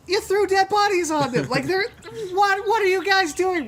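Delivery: high, loud, spluttering outrage; appalled mock shout. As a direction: yell in horror at your teammates. Teammate discovers bodies on guards.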